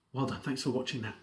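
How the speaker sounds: usual speaking voice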